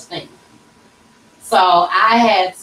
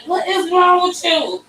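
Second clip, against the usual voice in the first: higher-pitched imitation of a puzzled husband; baffled husband; 10 semitones higher than usual. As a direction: mimic a husband asking in bewilderment, pitched up. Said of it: "my husband looking at me like".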